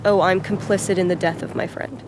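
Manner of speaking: normal talking voice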